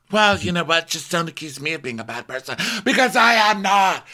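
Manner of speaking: high-pitched mock protest; indignant self-defence. [name] denies being a bad person, indignant high protest.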